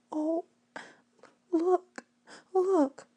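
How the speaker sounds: high, soft trembling voice; girl shaking at a raindrop; trembling awe. A narrator's reading. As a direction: breathe it out, shaken. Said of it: "she said, trembling".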